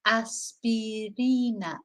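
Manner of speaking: aspirina said slowly with a Spanish ee, as-pee-ree-na; slow careful model of aspirina; Spanish pronunciation. Shown as a demonstration. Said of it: "not aspirina".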